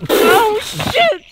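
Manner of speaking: shrill-pitched panic, yelping whoa and no; panicked high yelps. Acted. Newcomer losing his only clothes, panicked high yelps. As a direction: shriek like everything is going wrong.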